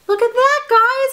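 high, loud exclamation to viewers; excited call. Real, not acted. Vlogger showing viewers the toothpaste, excited call.

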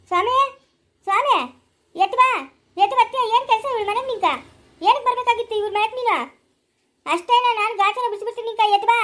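high, loud angry calling; angry shouting. Acted. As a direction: yell at him to get up and come. Angry wife shouting at door. (ಸಿಟ್ಟಿನಿಂದ ಗಂಡನನ್ನು ಕೂಗುವುದು)

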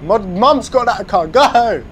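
loud, high cheering at the car; excited shouting. Real, not acted. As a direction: yell go, thrilled. Cheering a Jaguar on the road.

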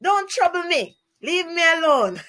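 mock a pestered schoolkid; poked child. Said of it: "the poked child will say".

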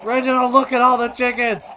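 loud, high urging; high, loud and eager; excited shouting. Real, not acted. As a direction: yell excitedly to a pet. Urging the fox on.

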